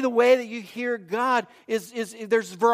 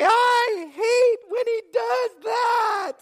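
very high, slow wailing falsetto; hammed-up squeal of a horrified mom; imitated shrieking mother; 3 dB louder, much slower than usual. Wife reacting to collisions in her sons' football game, shrieking. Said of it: "one of her favorite ones ... she would say".

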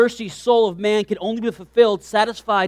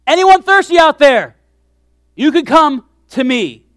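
shouted call; 6 semitones higher, 9 dB louder than usual. Acted. Preacher repeating Jesus's cry to the thirsty, loud shout. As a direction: call it out in all caps. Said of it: "it would have been all caps".

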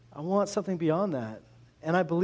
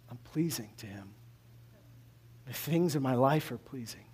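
hushed sincere; 5 semitones lower, 7 dB quieter than usual. Retold or imitated. Quiet sincere declaration.

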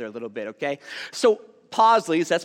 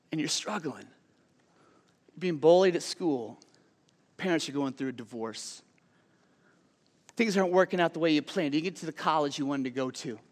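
softer, gentle pastoral plea; tender appeal; 4 semitones lower, 7 dB quieter than usual. Acted. Preacher to struggling teens, softer and gentle. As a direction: lean in and comfort the hurting kids.

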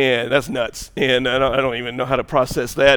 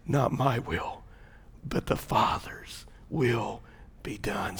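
ordinary talk, nothing marked

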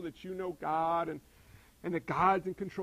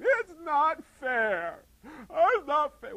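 slow, whining it's not fair, higher and louder; drawn-out whine; 7 semitones higher, 8 dB louder, much slower than usual. Retold or imitated. Pastor mimicking kids complaining at home, slow whine. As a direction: whine it like a sulking kid. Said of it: "We hear that in my house all the time".

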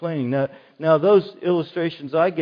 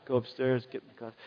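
normal talking voice

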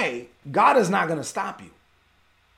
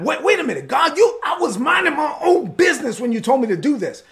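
raised, indignant protest to God; exasperated, higher-pitched outburst, demanding answers; acted upset with God; 4 semitones higher, 3 dB louder than usual. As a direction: yell your complaint up at God in frustration. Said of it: "You're upset with God".

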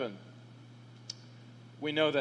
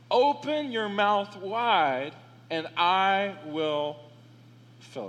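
solemn reading; slower than usual. Acted. Reading God's words, slow and grave.

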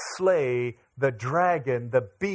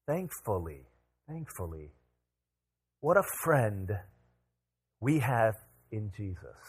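soft, lower, sincere turn; hushed sincere; 3 semitones lower, 5 dB quieter than usual. Real, not acted. Preacher turning the congregation to Jesus, hushed and sincere. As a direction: let the relief settle in quietly.

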